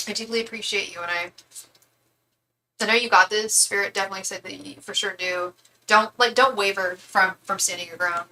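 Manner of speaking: normal talking voice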